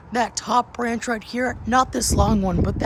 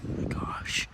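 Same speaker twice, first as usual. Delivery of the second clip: awed whisper; 13 dB quieter than usual. Real, not acted. Awed at strong wind.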